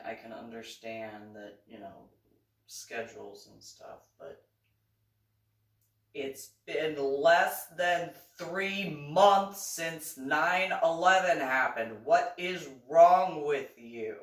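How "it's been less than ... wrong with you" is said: loud, high, slow exasperated outburst; venting hard at rude passengers; indignant raised voice; exasperated rant. Real, not acted. Ranting about 9/11 security.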